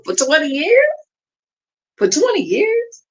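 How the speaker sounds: high rising incredulous repeat; can't believe it, asking twice; high incredulous for twenty years; disbelief. Real, not acted.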